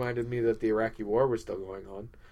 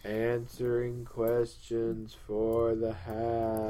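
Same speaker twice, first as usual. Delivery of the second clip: slow, droning hat devotee; mind-controlled trance; 3 dB quieter, much slower than usual. Pretend. Devotee talking over doubting co-host, mind-controlled trance. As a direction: drone it slowly, as if hypnotised. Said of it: "complete and total mind control".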